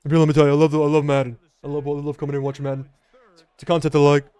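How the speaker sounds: monotone rumbling big-man impression, pitch barely moving; Shaquille O'Neal impression. Streamer joking to chat as if the real star loved Madden, Shaq impression. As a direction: do a mock celebrity athlete voice, level and unvarying. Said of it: "Fire the Shaq impression".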